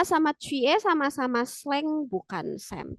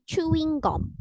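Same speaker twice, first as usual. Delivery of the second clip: "chewing gum" in a Korean accent, gum turned to "gom"; 4 semitones higher, slower than usual. Shown as a demonstration. Teacher contrasting Korean pronunciation with English, Korean accent. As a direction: say "chewing gum" as Konglish, chu-wing-gom. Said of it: "dalam bahasa Korea mereka nyebutnya".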